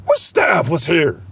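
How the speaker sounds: a hint of a tense, pushy boss asking; lightly voiced boss. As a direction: question the staff like he's itching to fight. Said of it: "That's how his voice sound".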